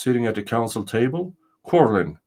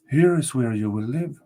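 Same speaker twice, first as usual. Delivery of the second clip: castle spirit; 3 semitones higher than usual. Acted. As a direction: announce yourself as the ancient spirit.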